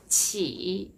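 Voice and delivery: ordinary delivery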